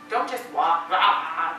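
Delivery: loud, low dismissive burst; mock rushing. Shown as a demonstration. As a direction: toss out a loud, careless blah. Mimics hurried careless walking.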